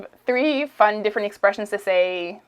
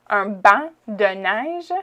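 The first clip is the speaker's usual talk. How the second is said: Quebec accent demo; much slower than usual. Shown as a demonstration. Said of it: "I'm saying it à la québécoise".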